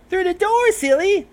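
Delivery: very high, bright, ditzy voice; chirpy Derpy. Acted. Derpy answers Vinyl, very high and ditzy.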